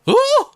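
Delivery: high, loud squeal of surprise; imitated startled "ooh". Retelling his wife's startled cry, high squeal. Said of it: "I hear her go".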